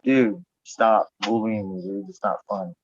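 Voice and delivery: slow, drawn-out plea; slow mock whine. Being crushed by a horse, slow joking plea. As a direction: plead slowly, half joking. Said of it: "I'm like".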